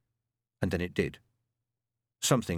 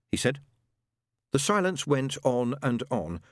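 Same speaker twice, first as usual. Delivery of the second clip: plain reading voice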